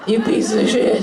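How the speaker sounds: ordinary delivery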